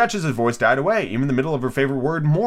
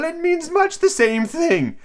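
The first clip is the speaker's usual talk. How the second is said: Duchess voice; much slower than usual. Acted. Duchess voiced in read story.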